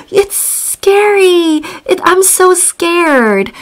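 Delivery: high, slow frightened voice; mock scared. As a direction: shrink away from the screen. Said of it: "and then, like".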